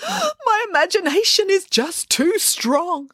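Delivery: high, slow, tearful wail; sobbing. A narrator's reading. Boris excusing himself to his nanny, sobbing. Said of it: "sobbed Boris".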